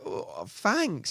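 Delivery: retold reply, lost for words; speechless thanks. Answering a stranger's insult, retold as speechless. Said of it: "a bit speechless".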